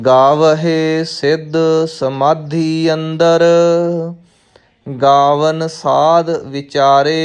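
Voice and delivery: measured chant-like recital with drawn-out line endings; scripture recitation. Shown as a demonstration. Teacher reciting Gurbani for new students, measured chanted recitation. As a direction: recite slowly on a level, sustained tone.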